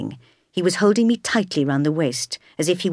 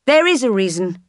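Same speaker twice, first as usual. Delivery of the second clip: raised, carrying voice from the back; firm, louder public objection; clear interjection; 3 semitones higher, 5 dB louder than usual. A narrator's reading. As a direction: speak out clearly to the whole church. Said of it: "a voice from the back of the church said clearly".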